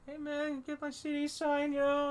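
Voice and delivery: high eager fan voice; high mock fan voice. Imitating fan asking for signature.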